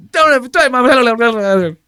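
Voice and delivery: high, loud garbled parody; mocking mimicry. Host parroting the man defending his mom, hammed-up outrage. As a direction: exaggerate his threat into a high squawk.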